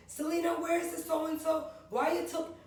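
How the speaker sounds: high, loud scolding mom voice; mother scolding. Retold or imitated. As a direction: call out like a nagging mother. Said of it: "my mother would be like".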